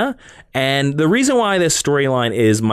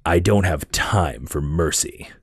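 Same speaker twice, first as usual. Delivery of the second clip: low, slow Batman growl, lower; Batman line; 7 semitones lower, 3 dB quieter, slower than usual. Retold or imitated. Host quoting Batman refusing mercy to Wonder Woman. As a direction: brood over every word. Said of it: "here's the Batman line".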